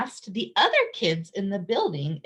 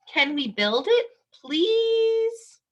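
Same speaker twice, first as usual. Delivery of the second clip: please rising much higher, drawn out; pleading please; 13 semitones higher, slower than usual. Acted. Children asking the landlord to build a playground, pleading. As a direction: beg sweetly on the please.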